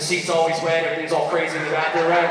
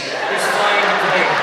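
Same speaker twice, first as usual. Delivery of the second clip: relieved nervous lady; 3 semitones higher, 6 dB louder, much slower than usual. Retold or imitated.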